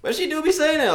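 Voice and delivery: high, loud insistence; insistent protest. Real, not acted. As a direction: protest loudly at being doubted. Protesting that his imitation is accurate.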